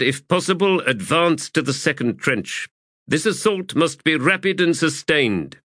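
brief the troops with crisp authority; High Fist giving orders. A narrator's reading. High Fist orders waiting soldiers into the assault, formal projected command.

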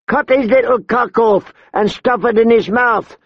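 high-pitched, crude suggestion; vicious woman brigand. A narrator's reading. Short woman to gang about the boy, vicious. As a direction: urge it on in a high voice.

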